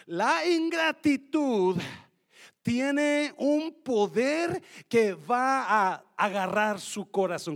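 loud, driving sermon delivery; preaching at full force. Acted. Pastor rousing the congregation about gratitude, loud preaching. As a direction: preach it to the back row.

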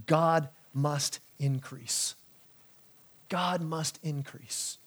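very slow, low and hushed; solemn main point. Real, not acted. First point stated, then repeated.

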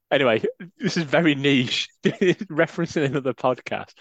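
ordinary delivery

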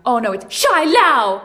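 loud, showy Mandarin pronunciation of "Shai Lao"; mock Mandarin accent. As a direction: correct them with over-the-top Mandarin flair. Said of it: "saying it like Samurai Pizza Cats".

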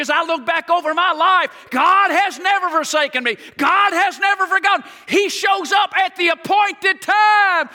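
high, loud, building to a shout; full-force preaching. Acted. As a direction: preach it up to the rafters.

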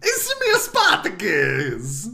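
slow mock Italian Mario accent; drawn-out cartoon Italian Spartacus. Repeating the joke, slow Mario-style Italian accent. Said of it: "done with Mario accents".

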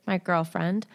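ordinary delivery, nothing marked

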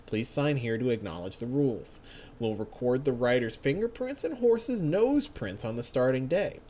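quiet, even official announcement read; formal rules read. Acted. Reads the race rules aloud.

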